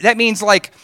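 ordinary talk

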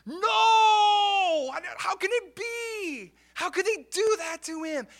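high, crying out in anguished disbelief; a boy's sobbing cry of shock; grieving cry. Retold or imitated. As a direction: wail it through the grief. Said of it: "And he's grieving".

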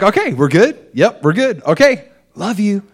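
high, loud, bouncy reassurance; eager wrap-up. Retold or imitated. Husband eager to get back to prayer, high and loud.

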